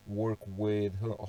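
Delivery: ordinary delivery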